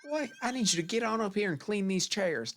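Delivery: high, hammed-up Southern mother; mom's Southern accent. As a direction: imitate a mom calling out in a Southern accent. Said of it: "she was just like".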